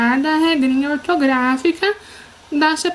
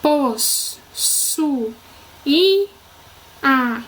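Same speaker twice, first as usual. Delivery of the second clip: pos, su, i, a as separate beats; syllable by syllable; much slower than usual. Shown as a demonstration. Teacher showing why i stands alone, pos, su, i, a separately. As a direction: sound out pos, su, i, a with pauses between. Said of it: "Porque quando eu falo".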